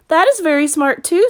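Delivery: put-on small boy's voice, high and eager; young Caleb. A narrator's reading. Caleb asking his sister, high child voice.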